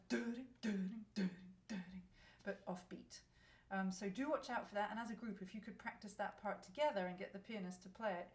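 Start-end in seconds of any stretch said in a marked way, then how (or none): 0.1-2.0 sung rhythm syllables; rhythm vocalized. Shown as a demonstration. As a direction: scat the offbeat pattern. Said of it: "creates this lovely kind of".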